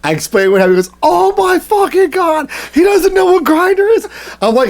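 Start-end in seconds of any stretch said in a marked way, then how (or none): 1.0-4.1 high-pitched squeal of amazement; re-enacted high, incredulous delight; retold gleeful disbelief. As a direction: squeal it high, imitating a buddy in hysterics. Retold buddy's hysterical disbelief.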